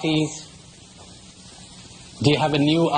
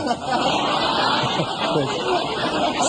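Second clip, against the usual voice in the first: high breathy laugh; real laugh; 9 semitones higher than usual. Real, not acted. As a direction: laugh through the punchline. Laughs after horse thief joke.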